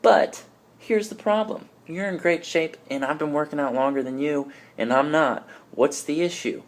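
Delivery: frustrated gym-goer. Retold or imitated. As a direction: complain in a high, put-on voice about lacking results. Acted frustrated gym-goer complaint.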